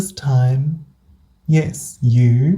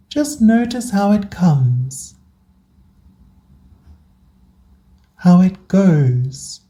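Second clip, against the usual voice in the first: guided meditation. Acted. Host guiding burnt-out listeners into rest, calm meditation voice. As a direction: guide them gently through each breath, unhurried.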